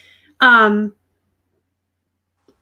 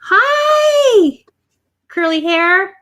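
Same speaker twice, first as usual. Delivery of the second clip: excited high greeting; 8 semitones higher, 5 dB louder, much slower than usual. Real, not acted. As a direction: squeal hi up high. Greeting toddler on camera.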